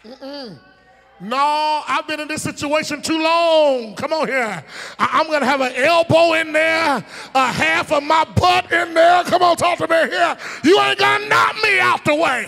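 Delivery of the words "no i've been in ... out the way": rising to a loud, high shout; preaching shout. Acted. Preacher acting the sick man, loud high shout. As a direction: build it up and belt it out.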